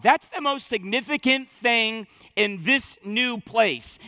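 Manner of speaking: ordinary delivery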